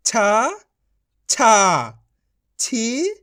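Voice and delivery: drawn-out syllable drill, each one separate; बारहखड़ी recitation. Shown as a demonstration. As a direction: chant each syllable for pupils to repeat, pausing between. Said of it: "मैं किस तरीके से पढ़ रहा हूँ". (बारहखड़ी का खिंचा हुआ पाठ)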